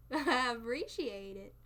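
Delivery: slow, drawn-out Southern thank-you; Southern drawl with a laugh in it; playful Southern accent. Acted. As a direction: draw it out like a gracious Southerner. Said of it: "Southern accents are so".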